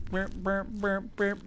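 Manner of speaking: short high vocal blips, evenly repeated; playful high mouth beeps; imitated beeping noise. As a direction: make little repeated "burp" noises.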